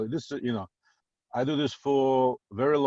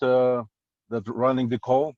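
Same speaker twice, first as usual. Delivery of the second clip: usual speaking voice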